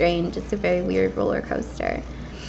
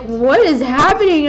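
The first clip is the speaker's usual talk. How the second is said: alarmed mom; 6 semitones higher, 6 dB louder than usual. Retold or imitated. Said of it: "my mom was like".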